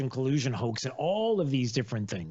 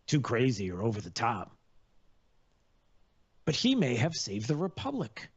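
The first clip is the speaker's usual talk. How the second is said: ordinary delivery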